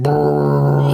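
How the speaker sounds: long buzzing trilled drone; drawn-out low electric brrr; imitated electrical hum. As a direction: mimic a substation's mains hum. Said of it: "walk past the electric substation".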